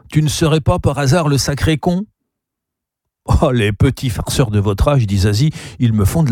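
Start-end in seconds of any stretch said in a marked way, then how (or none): 0.1-2.1 higher, playful rising question; jovial teasing. A narrator's reading. Gabriel teasing Charles about his answer, jovial. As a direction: needle a friend good-humouredly. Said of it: "dit Gabriel jovialement".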